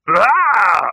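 sudden high, loud ape cry mid-swing; a startled ape's loud yelp; shocked orangutan shriek as the target is missed; ape yell. A narrator's reading. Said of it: "This was the noise made".

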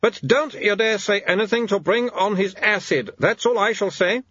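high-pitched haughty warning. A narrator's reading. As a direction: warn the guest off as a protective wife. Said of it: "she said haughtily".

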